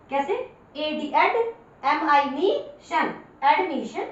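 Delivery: ordinary delivery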